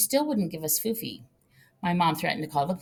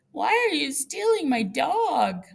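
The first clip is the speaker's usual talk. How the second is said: crying plea; 10 semitones higher, 3 dB louder, slower than usual. Retold or imitated. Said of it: "I started crying".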